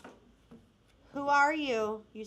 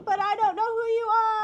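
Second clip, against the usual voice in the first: high, louder puzzled plea; mock confusion; 10 semitones higher, 7 dB louder than usual. Host acting out confusion at the username, high and loud. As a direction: play up the confusion. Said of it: "I'm like".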